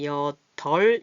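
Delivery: 여덜 slowly split as 여-덜; 여덜 as yeo-deol, drawn out for learners; pronunciation model. Shown as a demonstration. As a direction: say 여덜 with only the ㄹ at the end, no b. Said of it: "이렇게 읽습니다".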